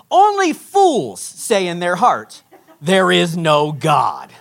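slow, low and firm; emphatic punchline reading. Retold or imitated. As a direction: land each word deliberately, at full voice. Completes the verse, prank revealed.

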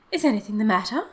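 breathless, worried mother; breathless alarm. A narrator's reading. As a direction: ask in sudden worry, voice lifting. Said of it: "she asked breathlessly".

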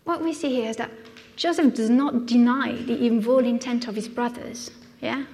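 normal talking voice

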